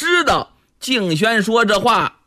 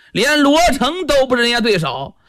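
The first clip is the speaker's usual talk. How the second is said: plain reading voice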